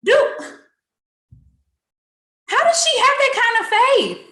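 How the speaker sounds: high, loud astonishment; amazed outburst. Real, not acted. Telling the church, high and loud with amazement. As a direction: marvel out loud.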